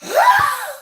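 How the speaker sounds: high, loud gasp; swooning gasp. Acted. Fan reacting to her favorite, high loud gasp. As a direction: gasp hard as if swept away.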